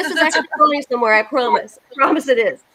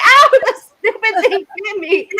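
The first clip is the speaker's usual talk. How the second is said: higher and slow, a laughing ow of pain; mock yelp of pain; 9 semitones higher, 4 dB louder than usual. Shopper bitten by a clothes rack, laughing mock pain. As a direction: yelp like a shirt just bit you. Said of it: "and you're like".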